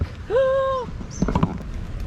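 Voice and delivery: sharp startled gasp at the sight; shocked gasp. Real, not acted. Woman sees huge snake.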